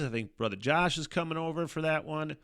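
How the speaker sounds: usual speaking voice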